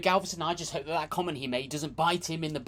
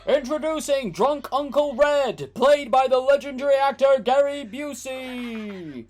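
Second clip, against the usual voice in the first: loud, higher showbiz announcer; mock announcer; 8 semitones higher, 7 dB louder than usual. Introducing Drunk Uncle Red to viewers in a loud announcer voice. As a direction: present the star with fanfare.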